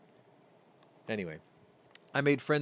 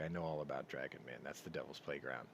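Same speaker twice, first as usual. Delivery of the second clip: 1 knowing aside; 8 semitones lower, 11 dB quieter, faster than usual. Real, not acted. Dropped aside about Dragon Man.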